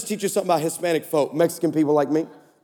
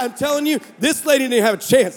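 ordinary delivery

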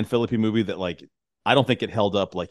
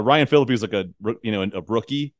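ordinary delivery

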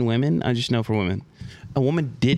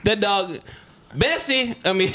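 ordinary talk

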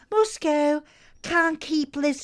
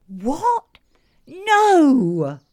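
high loud disbelieving no; shocked outcry; 5 semitones higher, 9 dB louder than usual. Acted. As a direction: react in horror. Hears show was cancelled.

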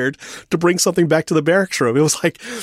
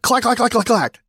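clack, clack, clack, set higher, like a rifle banging on the floor; clacking rifle noise; 10 semitones higher than usual. Retold or imitated. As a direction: tap out the rifle's clack with your voice.

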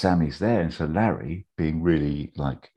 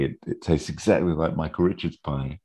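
ordinary delivery

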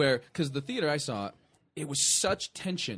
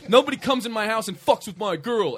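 louder, higher macho challenge; tough guy; 6 semitones higher, 9 dB louder than usual. Retold or imitated. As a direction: puff up like a tough boyfriend. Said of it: "the boyfriend thing where he goes".

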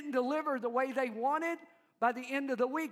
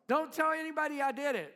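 hushed urgent Jesus; 5 semitones higher, 5 dB louder, faster than usual. Retold or imitated. Preacher voicing Jesus to the healed leper, hurried and higher. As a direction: rush it out like a secret you can't let slip. Repeats quote faster, higher.

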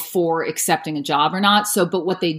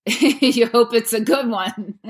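said through a light chuckle, pitched up; laughing; 5 semitones higher, slower than usual. Real, not acted. Narrator admitting the gamble of picking a book, laughing. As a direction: laugh gently as you say it. Laughs at her own hope.

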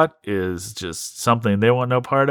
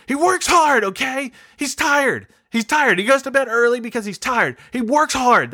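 much higher, insistent mock defense; defensive Priscilla; 12 semitones higher than usual. Priscilla defending the catfish to friends, high insistent voice. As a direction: shrilly insist he's just tired. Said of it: "She'd say".

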